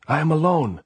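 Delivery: very loud high desperate cry; desperate shout. A narrator's reading. Andres pleading with the sentries, desperate shout. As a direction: yell it up the slope.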